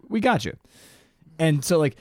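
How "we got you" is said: boss Cliff. Retold or imitated. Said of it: "he's like".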